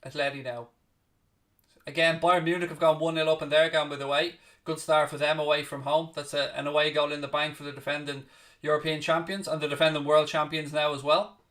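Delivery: steady play-by-play, calling each pass as it happens; live commentary. Acted. Calling the Atletico v Chelsea match for viewers, play-by-play commentary. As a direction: talk the match through like a broadcast caller.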